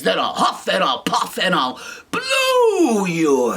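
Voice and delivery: slow, loud wolf threat; threatening wolf. Acted. Wolf threatening the pigs, slow loud threat. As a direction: growl the threat slowly. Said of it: "the wolf says".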